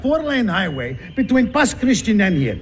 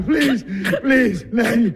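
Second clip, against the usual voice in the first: ordinary talk, nothing marked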